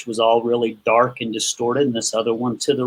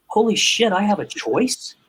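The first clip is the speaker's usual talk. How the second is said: imitating his stunned discovery, pitched much higher; mock astonished realisation; retold amazement; 7 semitones higher than usual. Retold sudden realisation in therapy.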